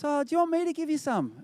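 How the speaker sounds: lightly voiced Good Samaritan; imitated helpful stranger. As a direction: pipe up as a kindly passenger behind. Voices helpful passenger behind him.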